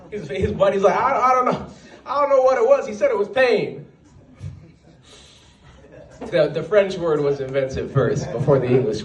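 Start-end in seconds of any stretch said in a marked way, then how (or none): 0.9-3.9 high, stammering mock fluster; a touch of panicked bewilderment. Buddy explaining the attack to his friend, mild mock panic.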